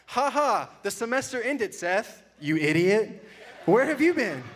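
hammed-up reading of a teasing reply; reading her reply. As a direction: read the wife's teasing reply. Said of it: "she was so kind".

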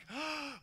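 a sudden high gasp of alarm; sharp gasp. Retold or imitated. Panicked gasp in drifting kayak.

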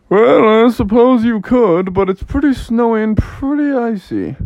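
storybook father's reluctant warning; doubtful dad. Acted. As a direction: start loud, hedge reluctantly. Dad hesitates about snow.

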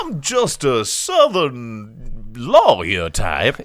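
slow hammed-up Southern drawl; mock Southern lawyer. Host parodies a film's Southern lawyer in a slow drawl. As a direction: stretch it like a fake Southern lawyer. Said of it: "gave a Southern accent to".

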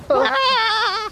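very high held squeak; high squeal. Acted. Puppet exits with squeal.

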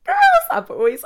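dramatic squealed address to the audience; loud high call. Acted. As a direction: call out to the listeners like a shriek. Calling to her audience.

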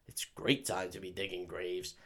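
low, soft put-on gravedigger voice; quietly insist, acting innocent; shifty gravedigger. Gravedigger excusing his late digging to Van Helsing, low soft voice.

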